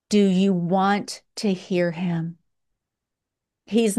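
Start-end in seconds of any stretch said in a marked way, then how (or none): none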